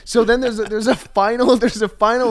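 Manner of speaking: usual speaking voice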